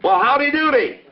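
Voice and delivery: "Well, howdy doody" called out high and loud; bright greeting. Shown as a demonstration. Puppet shows the better greeting, high and loud. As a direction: call out the greeting warmly. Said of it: "I like to say".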